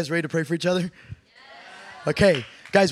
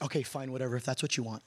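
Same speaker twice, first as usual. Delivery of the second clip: low, soft, resigned imitation; grudging consent; 7 semitones lower, 11 dB quieter, faster than usual. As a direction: give in reluctantly, as a retold reply. Lady grudgingly agrees to prayer.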